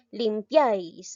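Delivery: Limpiáis drawn out, stress on the final syllable; teacher slowly modelling Limpiáis; slow demo word. Shown as a demonstration. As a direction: stretch Limpiáis slowly for the class. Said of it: "¿ve?".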